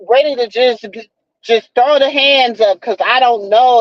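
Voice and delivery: usual speaking voice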